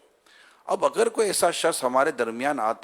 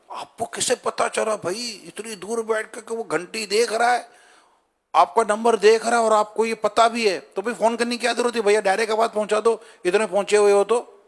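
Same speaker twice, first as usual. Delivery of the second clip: raised, mocking rebuke; sarcastic retort; 6 semitones higher, 3 dB louder than usual. Real, not acted. Preacher mocking the suspicious caller, sarcastic and higher. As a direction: tease them for their suspicion. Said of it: "mocking the suspicious caller".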